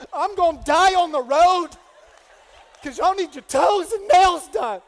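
hammed-up desperate shouting at his wife; frantic rant. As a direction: rant in mock panic about money. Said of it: "I'm like".

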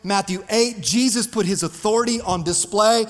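normal talking voice

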